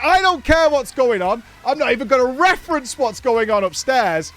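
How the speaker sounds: imitating a host ploughing on at full volume; mock defiant resolve. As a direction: insist the show goes on, loud and emphatic. Acts out server's determined stance.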